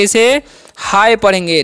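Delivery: हाय said slowly, h plus the English "eye" sound; teacher spells out हाय for beginners; slow pronunciation demo. Shown as a demonstration. Slowly models हाय for students. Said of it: "आय करना है".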